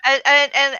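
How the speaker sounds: ordinary delivery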